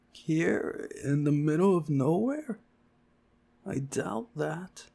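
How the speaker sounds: milk the doubt theatrically; dramatic. Acted. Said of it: "stop it with that drama".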